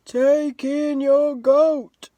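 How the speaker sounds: slow, drawn-out sage voice; a very slow, measured wise old man. Acted. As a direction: speak slowly like a calm old sage. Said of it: "said the wise old man".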